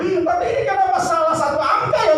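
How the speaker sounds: high laughing insult; laughing scold. Acted. (memaki sambil tertawa)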